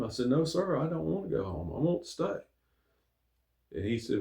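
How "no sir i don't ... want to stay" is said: hurt pleading. Retold or imitated. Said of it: "and I was hurt".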